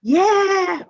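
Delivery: put-on very high baby squeal; baby joining in, tiny high voice. A narrator's reading. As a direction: coo like a delighted baby. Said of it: "And baby added".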